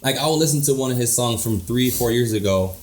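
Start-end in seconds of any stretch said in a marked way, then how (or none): none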